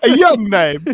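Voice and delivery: loud mock of the misread line; hammed-up loud repeat of the slip; teasing a friend's misreading, loud and mocking. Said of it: "He didn't have one of those old names".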